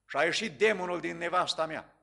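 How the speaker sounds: ordinary talk, nothing marked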